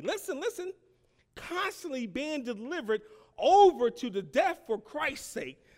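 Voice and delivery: loud, high scripture reading, stretched out for weight; ringing, emphatic pulpit delivery; full-force preaching. Real, not acted. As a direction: preach the verse out with rising fervor.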